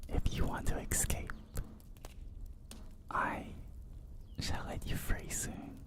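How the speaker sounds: very soft, teasing whisper; hushed whisper, promising release; whispered promise. Acted. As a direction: whisper it like a playful captor. Playful captor promising release.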